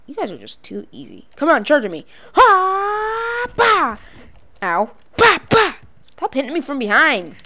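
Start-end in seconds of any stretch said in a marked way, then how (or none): 2.3-4.0 high held wail, then a sharp bah; kung fu cry. Pretend. Player answering a charge, mock martial-arts cry. Striking enemy in game. 5.2-5.7 two loud, high "bah" bursts; punch cries. Pretend. Player hitting game enemies, loud mock strike cries.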